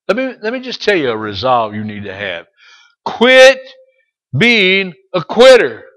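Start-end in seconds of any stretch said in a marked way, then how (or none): 3.0-5.8 high, loud and slow, quit punched; preaching shout. Acted. Preacher drilling the congregation, loud and slow. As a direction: belt it from the pulpit.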